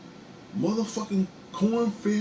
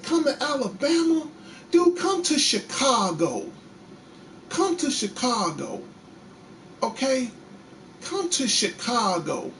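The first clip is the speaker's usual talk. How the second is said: taunting challenge; 7 semitones higher than usual. Real, not acted. Challenging racists to visit.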